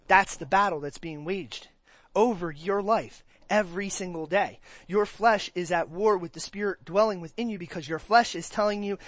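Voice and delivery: normal talking voice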